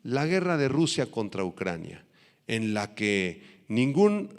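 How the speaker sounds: normal talking voice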